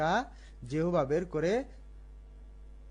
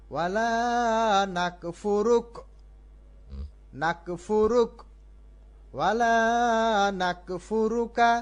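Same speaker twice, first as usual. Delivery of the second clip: higher and louder recital of ওয়ালা নাকফুরুকা; higher recitation; 6 semitones higher, 3 dB louder than usual. Shown as a demonstration. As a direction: lift the whole phrase up. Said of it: "অথবা".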